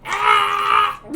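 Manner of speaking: high loud squawking Ah; cat yelp. Retold or imitated. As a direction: squeal it like a startled cat. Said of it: "one of my favorite kinds of cat noises".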